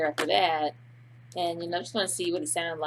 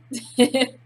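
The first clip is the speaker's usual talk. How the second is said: giggling laugh; 6 semitones higher, 10 dB louder than usual. Real, not acted. Laughs after love story line.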